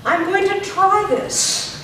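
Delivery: high, loud and slow; drilled projection for repeating; projected, drawn out. Shown as a demonstration. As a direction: sent to the back, unhurried. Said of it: "talk nice and loud".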